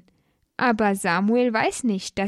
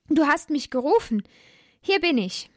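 put-on raised, eager boy's voice; a child answering his master keenly; young Samuel; 6 semitones higher than usual. A narrator's reading. Boy Samuel runs to Eli at night, higher eager child voice.

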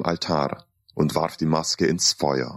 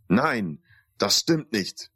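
ordinary delivery

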